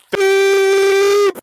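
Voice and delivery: high loud bleep sound; loud mock bleep; censor beep. Shown as a demonstration. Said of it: "I should probably beep that".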